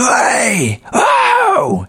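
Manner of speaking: very high, stretched-out holler; imitated screaming. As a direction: mimic a man screaming at someone. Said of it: "he was screaming at me".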